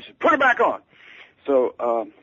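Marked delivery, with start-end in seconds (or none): 0.2-0.8 high, loud, brusque demand; high-pitched, loud impersonation of a famous singer on the phone. Retold or imitated. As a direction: bark it down the phone like a star. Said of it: "shouting so loudly".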